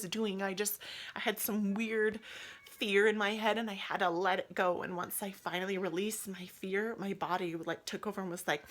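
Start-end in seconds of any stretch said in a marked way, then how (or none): none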